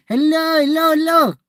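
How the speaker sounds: high loud call to get attention; loud high-pitched call; calling out loudly. Real, not acted. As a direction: shout across to a stranger. Calling out to man outside.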